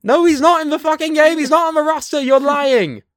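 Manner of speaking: high, loud exasperated yelling; angry shout. Real, not acted. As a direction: yell him down in frustration. Heated argument.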